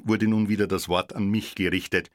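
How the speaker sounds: ordinary delivery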